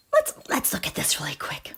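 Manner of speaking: soft whispered aside. Real, not acted. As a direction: mutter it under the breath. Whispered aside while searching.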